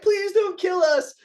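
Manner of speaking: high, slow whiny begging; hammed-up cowards begging for their lives; mock pleading. Scared Chads begging the samurai, high mock whine.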